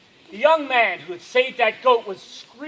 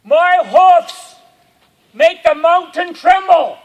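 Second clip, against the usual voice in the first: booming, high, loud goat roar; thunderous drawn-out cry; screamed out; 7 semitones higher, 12 dB louder, slower than usual. A narrator's reading. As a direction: scream it out slowly as a giant mountain goat. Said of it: "this mountain goat screamed out".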